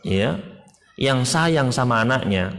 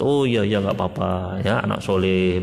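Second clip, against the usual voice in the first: soothing parent; 5 semitones lower than usual. Pretend. Parent welcoming sorry child.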